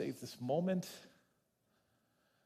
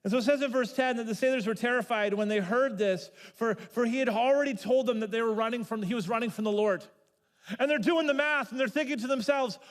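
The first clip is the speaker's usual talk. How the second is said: ordinary talk, nothing marked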